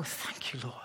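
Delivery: breathy whispered prayer; quiet whispered thank-you; whispered thanks to God. Real, not acted.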